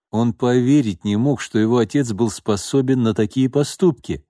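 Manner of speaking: usual speaking voice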